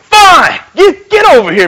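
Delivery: loud, high, grudging surrender; exasperated mock shout. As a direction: imitate someone giving in with a yell. Acts out man giving in angrily.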